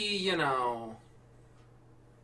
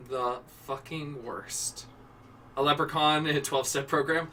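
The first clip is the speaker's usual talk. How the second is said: usual speaking voice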